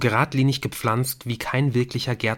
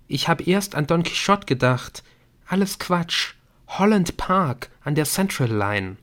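higher-pitched, breathless recounting; breathless excitement; 6 semitones higher than usual. A narrator's reading. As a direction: tell your discovery while short of breath. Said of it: "sagte sie atemlos". (atemlos aufgeregt, mit höherer Stimme)

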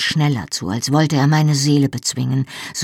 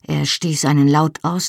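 ordinary delivery

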